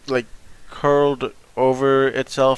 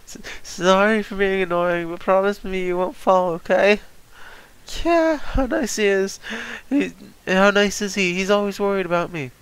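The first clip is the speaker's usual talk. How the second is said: put-on girly voice; 8 semitones higher than usual. Voices Pudding, girlish lines.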